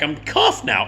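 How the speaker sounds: plain reading voice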